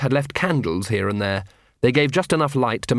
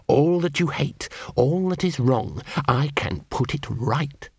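slowing, fading seductive promise; sinister gun voice; slower than usual. Acted. The gun tempts Vimes.

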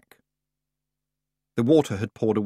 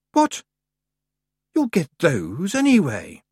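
confused and angry; 6 semitones higher, 3 dB louder than usual. Acted. Summer Lady indignant retort.

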